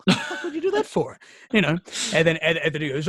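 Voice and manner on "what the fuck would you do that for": high-pitched, exasperated disbelief; mock incredulity. Retold hunter hearing the gun is in the car, incredulous. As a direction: imitate a mate who can't believe it. Acts out friend's reaction to forgotten gun.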